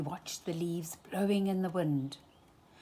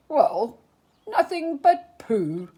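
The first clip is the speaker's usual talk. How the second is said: louder, higher, startled donkey; Eeyore dismayed; 5 semitones higher, 7 dB louder than usual. A narrator's reading. Eeyore after the wind wrecks his house, loud dismay. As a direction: blurt it out in surprise.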